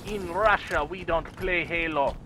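put-on slow, heavy Russian accent joke; deadpan Russian accent. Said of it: "It is my Russian voice".

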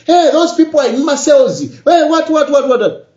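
angry social media rant; mock shouting. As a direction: play the loudmouth ranting on social media. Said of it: "I start shouting".

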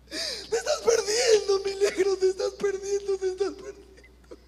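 mother weeping over her lost son; crying like Magdalene. Retold or imitated. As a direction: sob it, letting it fade. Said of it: "a llorar como una Magdalena".

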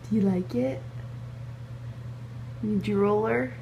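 ordinary talk

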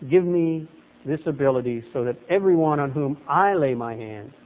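ordinary delivery

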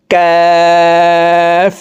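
"কাফ" with the vowel held very long on a high level tone; four-alif stretch. Shown as a demonstration. Teacher demonstrating kaf, long drawn-out hold. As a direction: draw out "কাফ" as one steady chanted note. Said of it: "কাফ হরফকে উচ্চারণ করতে হবে".

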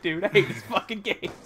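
ordinary talk

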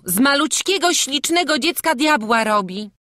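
high and loud indignant protest; angry retort. Acted. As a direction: scold them for blaming the child. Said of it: "zawołała Magda ze złością".

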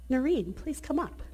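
ordinary talk, nothing marked

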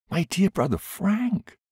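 high, bright-lifted joyful call of his name; joyous cry. A narrator's reading. Dying woman greeting her long-lost brother-in-law, joyously high. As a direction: cry out in glad surprise. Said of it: "I cried out joyously".

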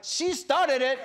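loud, high comic protest; hammed-up high defensive cry; indignant dog. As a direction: shift the blame as the accused dog. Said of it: "the dog is like".

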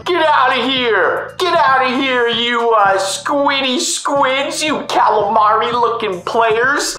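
high, drawn-out pretend yelling; playful, slow high holler; mock heroic scolding. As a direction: chase them off with hammed-up insults. Playing rescuer, chasing off squids.

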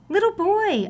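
high surprised greeting; high exclaimed greeting to a child; exclaiming. Acted. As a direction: call out in bright surprise. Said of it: "Amu exclaimed".

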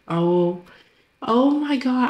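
usual speaking voice

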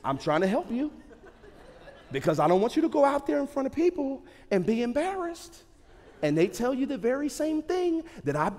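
a hint of an amused wife scolding; wife's voice. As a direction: coax him like a patient wife. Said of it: "my wife tells me this".

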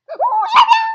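very high, very loud scream; startled shriek. Real, not acted. Player sees the earth coming, startled shriek. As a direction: shriek at the sudden sighting.

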